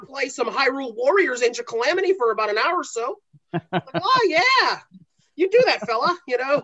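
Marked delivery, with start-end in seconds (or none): 0.0-3.2 put-on a light Fargo Minnesota accent; easygoing Upper Midwest Minnesota voice. Husband answering his wife in a light Minnesota accent. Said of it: "we're doing the accent". 3.9-6.2 put-on high, bouncy Fargo Minnesota accent; a folksy Minnesota "you do that". Wife replying to her husband in a Minnesota accent. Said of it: "we're doing the accent".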